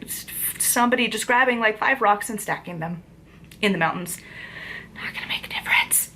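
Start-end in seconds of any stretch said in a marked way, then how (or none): 4.9-6.2 soft whispered aside with a little laugh. Real, not acted. Vlogger whispering to viewers about rock stacking. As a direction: whisper it to yourself, defeated.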